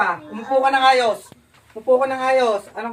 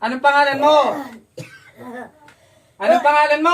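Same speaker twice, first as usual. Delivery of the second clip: stern demand; 2 semitones higher, 4 dB louder than usual. Real, not acted. Interrogator to a caught suspect, growing stern demand. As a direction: repeat it louder each time, sternly.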